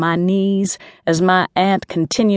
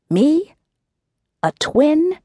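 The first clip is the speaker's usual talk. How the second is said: high, incredulous questions; can't believe what she just heard; shocked, pitched-up disbelief; stunned disbelief; 9 semitones higher, slower than usual. A narrator's reading. Mink stunned by news.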